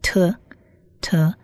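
"特" as an isolated t sound; pronunciation demo. Shown as a demonstration. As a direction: say "特" softly and briefly, pause, repeat. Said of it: "发音是发特的音".

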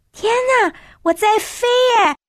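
high-pitched, excited cry of wonder; thrilled exclamation. A narrator's reading. Child finds they are flying on the glass bird, thrilled. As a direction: exclaim it high, amazed to be airborne.

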